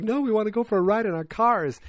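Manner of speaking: high-pitched mock excitement; freaking out. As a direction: imitate a thrilled group turning someone down. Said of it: "Everyone's freaking out about the cars".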